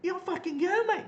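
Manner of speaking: high, loud jeering insult; mocking yell, high and loud; retold taunt. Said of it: "this guy just came out and said".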